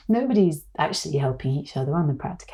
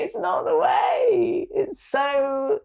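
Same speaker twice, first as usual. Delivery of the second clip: high, slow, tearful repeat; real crying, voice breaking; 14 semitones higher, much slower than usual. Real, not acted. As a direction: cry the words out.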